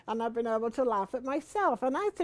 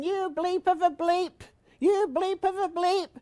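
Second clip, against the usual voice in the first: higher, hammed-up cursing with bleeps; mock swearing husband; 8 semitones higher, 4 dB louder than usual. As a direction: bleep out his curses in a mock rage. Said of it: "he was going".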